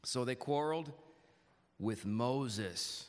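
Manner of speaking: "quarreled" and "Moses" set apart by pauses; low reading voice, weight on "quarreled" and "Moses"; emphasized words. Shown as a demonstration. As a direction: lean on "quarreled", then land deliberately on "Moses". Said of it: "I'm gonna emphasize certain words".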